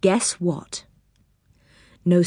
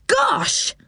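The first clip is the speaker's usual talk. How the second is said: high startled exclamation; boy startled by a walking bed; surprised gasp. Acted. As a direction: blurt it out in shock. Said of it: "stopping in surprise".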